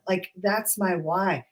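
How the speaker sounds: usual speaking voice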